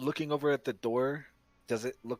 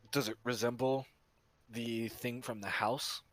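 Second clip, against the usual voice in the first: ordinary delivery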